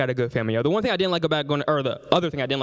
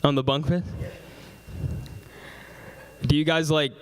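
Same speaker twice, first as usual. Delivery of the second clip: normal talking voice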